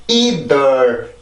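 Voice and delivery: 'either' as two separate Korean-like syllables; 'either' split in two, without English syllable stress; unstressed Korean-style syllables. Shown as a demonstration. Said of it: "이렇게 하는 게 가능하겠지만".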